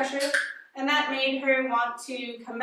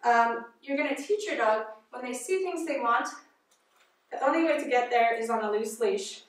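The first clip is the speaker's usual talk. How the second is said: ordinary delivery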